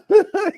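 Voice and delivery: short, very high giggle; a quick high laugh between words. Real, not acted. Still laughing at testimony.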